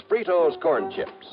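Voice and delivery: normal talking voice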